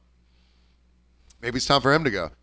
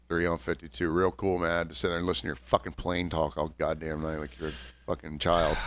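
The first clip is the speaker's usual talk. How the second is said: muttered anger; 9 semitones lower, 8 dB quieter than usual. Retold or imitated. Angry bartender retold, muttered.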